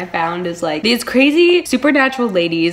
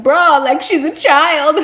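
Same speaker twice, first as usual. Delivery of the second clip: mock outburst; 7 semitones higher, 7 dB louder, slower than usual. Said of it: "And he's like".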